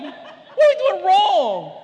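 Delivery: slow, high, laughing; laughing mock despair. Parent repeats the plea to his wife, laughing. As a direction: drag it out through a laugh.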